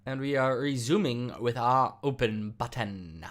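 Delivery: slow, mock English rally announcer accent; hammy English announcer accent, unhurried; bad English announcer accent. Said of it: "trying to sound like a person doing a bad, really bad English accent".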